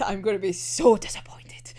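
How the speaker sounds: breathy whispered threat. Real, not acted. Fan warning the show in a menacing whisper. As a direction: drop to a whisper to sound ominous.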